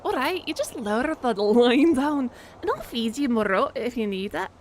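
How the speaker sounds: badly faked Scottish accent; terrible Scottish accent. Shown as a demonstration. Player trying accents for the game character, terrible Scottish. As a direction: do a hammed-up Scots accent. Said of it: "terrible Scottish or terrible Southern".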